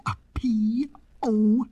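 slow, whispered letters P, O, P; suspenseful spelling. A narrator's reading. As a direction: spell it out breath by breath. Spells out the balloon's burst.

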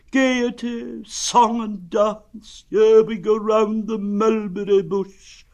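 high, slow, doleful recital; loud, drawn-out donkey lament; gloomy donkey. Acted. Donkey explaining gaiety to Pooh, gloomy.

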